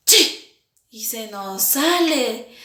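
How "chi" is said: chi fuerte de estornudo; estornudo fingido. Shown as a demonstration. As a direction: estornuda de mentira. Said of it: "Hacemos así". (estornudo de demostración)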